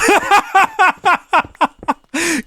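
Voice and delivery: high burst of laughter; laughing. Real, not acted. Creator cracking up at his own fantasy skit, high laughter. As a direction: break up laughing at the joke.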